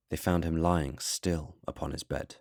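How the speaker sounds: plain reading voice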